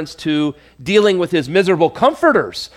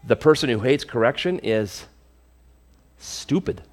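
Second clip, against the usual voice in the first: edgy word whispered; 5 semitones lower, 7 dB quieter than usual. Real, not acted. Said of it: "a word that we don't like to use".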